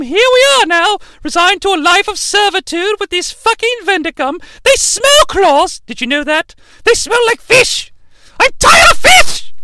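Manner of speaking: high falsetto nagging wife; mock wife voice. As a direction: whine it at your husband. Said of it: "She's like".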